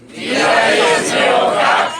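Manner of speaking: loud, slow chanted response; group shout. Real, not acted. Audience answers the host on three, loud shout. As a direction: shout the phrase back in unison.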